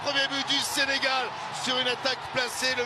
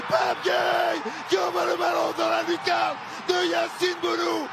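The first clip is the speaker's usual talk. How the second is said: goal shout. Real, not acted. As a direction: roar the scorer's name. Goal celebration call.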